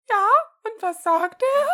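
loud, very high granny voice; grandma. A narrator's reading. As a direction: call out slowly as an eager grandmother. Grandma's reply on the phone.

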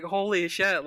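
retold astonishment, pitched high; amazed reaction. Podcaster recognised by a stranger, retold amazement. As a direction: relive being stunned, voice lifted high.

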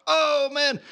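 put-on high, drawn-out cheer of amazed kids; kids erupting. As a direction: imitate a crowd of kids bursting out. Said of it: "And the kids erupt".

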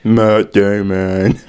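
very slow, drawn-out mock name-saying; dopey parody voice. Host mocking Boston movie regulars, slow parody voice. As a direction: stretch the name out as a silly caricature.

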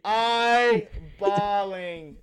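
slow, stretched-out confession; drawn-out emphasis. Retold or imitated. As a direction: drag each word out for comic weight. Exaggerates his own breakdown.

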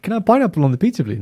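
high, fast, naive asking voice; mock tourist request. Mocking a tourist ordering pineapple pizza in Italy, high and fast. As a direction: ask it like a clueless foreigner.

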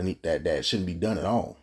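ordinary talk

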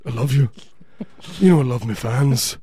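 lightly voiced rocker; a hint of rock star addressing the crowd; put-on frontman voice. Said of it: "I do a spot on [name]".

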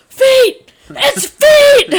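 high, very loud shouted correction; dad's yell. Retold or imitated. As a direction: imitate dad hollering at the song. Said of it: "he would just yell".

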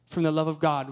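ordinary talk

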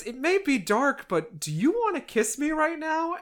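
high-pitched playful asking; mock-romantic question, pitched well up; coy invitation. Dolokhov asks Petya for a kiss, coy.

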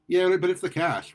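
high, slow, casual shrug-off; nonchalant. A narrator's reading. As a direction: breeze past the objection, drawn out. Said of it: "Veronica said nonchalantly".

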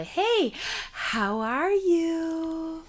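high, very slow syrupy greeting; mock concerned coworker. Mimicking coworkers greeting her in the hallway, syrupy and slow. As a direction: drag out the pitying hello.

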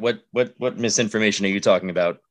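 ordinary delivery

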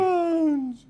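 long stretched high wailing vowels; drawn-out whale call voice; whale speak. Acted. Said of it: "this is not whale".

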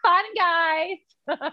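hammed-up American influencer voice; standard american accent. Shown as a demonstration. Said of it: "I sound so American".

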